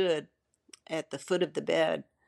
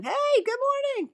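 bright sing-song hello, much higher; cheery greeting; 4 dB louder than usual. Retold or imitated. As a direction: chirp a morning greeting way up high. Husband's cheerful greeting at four.

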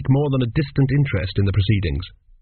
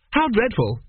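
cheerful, much higher pitch; 10 semitones higher, slower than usual. A narrator's reading. Boy reacting to schoolmate's tale of his dead mother, cheerful. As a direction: say it cheerfully, voice lifted high. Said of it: "said Chidder cheerfully".

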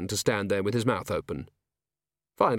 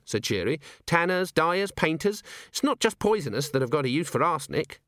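put-on bright young dwarf; 5 semitones higher than usual. Cheery voiced in reading.

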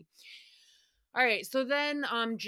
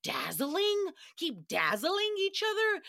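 pitched way up in disbelief; incredulous mock repeat; 9 semitones higher, slower than usual. Real, not acted. Host mocking a reality star's odd wording, incredulous high echo. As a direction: echo the phrase like it's absurd. Mocking a cast member's phrase.

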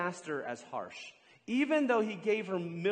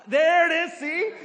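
delighted; 11 semitones higher, 11 dB louder than usual. Real, not acted. Volunteer finally pulls the mad look.

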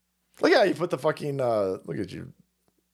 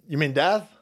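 usual speaking voice

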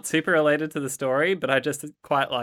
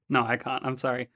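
normal talking voice

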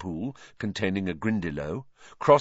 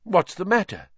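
higher, louder startled question; sudden alarmed ask; a startled teacher asking what's wrong; 10 semitones higher, 4 dB louder than usual. A narrator's reading. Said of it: "said Lupin, startled".

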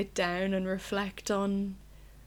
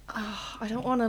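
usual speaking voice

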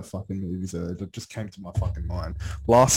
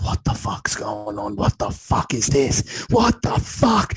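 put-on scared girlfriend; 5 dB louder, slower than usual. Girlfriend watching a horror film, slow frightened build. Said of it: "she's like".

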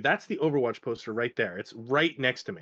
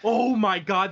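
startled exclamation; 6 semitones higher, 6 dB louder, slower than usual. Real, not acted. Sees a giant spider.

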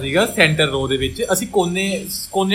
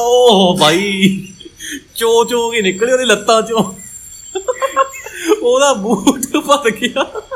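dramatic reveal; 5 semitones higher, 4 dB louder, slower than usual. Acted. Story climax, gross reveal.

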